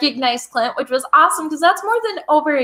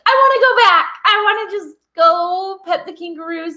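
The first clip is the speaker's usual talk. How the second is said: eager longing; 6 semitones higher than usual. Retold or imitated. Longing quote while rewatching video.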